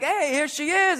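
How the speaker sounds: high, drawn-out cheery greeting; sing-song acted fanfare; mock proud presenting. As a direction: show off the kid to party guests. Acts dad presenting his kid.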